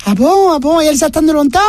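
voix d'enfant très aiguë et curieuse; petit garçon. Acted. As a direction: jouer un petit garçon qui interroge sa mère. (voix de petit garçon très aiguë)

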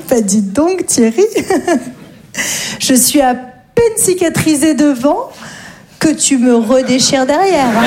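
réplique lente, à travers le rire; en riant. Real, not acted. Réponse au patron, en riant. (riant en répondant au patron)

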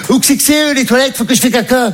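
high, naive mom voice; mother asking the crew, pitched high; mother's voice. Retold or imitated. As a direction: ask for the toilets like a guileless mother. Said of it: "elle fait".

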